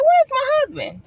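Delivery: high, loud, startled call; confused wake-up question. Retold or imitated. Wife waking alone, calling for her husband, high and startled. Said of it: "I'm like".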